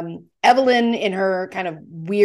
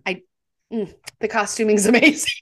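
ordinary delivery

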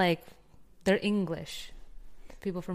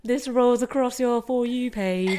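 put-on British accent; 3 semitones higher than usual. Host joking to co-host about the For You page, mock British accent. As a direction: slip into a playful British accent. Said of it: "[name]'s been on a British accent".

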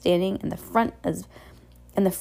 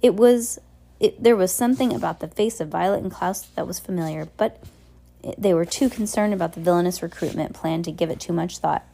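plain reading voice

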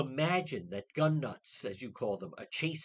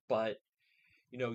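ordinary talk

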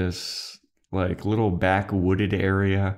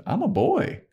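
put-on much higher childlike voice; a higher-pitched little-boy voice; kid voice. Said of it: "And the kid goes".

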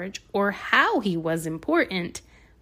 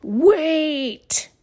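scary voice; 8 semitones higher, 5 dB louder than usual. Acted. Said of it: "said a very scary voice".

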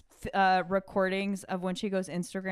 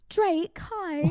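high-pitched British girl impression; 10 semitones higher than usual. Asked for an impression, greets [name] in high British girl voice. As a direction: put on a high young British star's voice. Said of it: "can you do your [name]?".

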